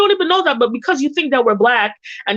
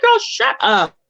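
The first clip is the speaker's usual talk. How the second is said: higher, sassy brush-off; higher mock-sassy girl, shut up; sassy dismissal; 10 semitones higher, slower than usual. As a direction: shut her down with attitude. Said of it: "I'm like".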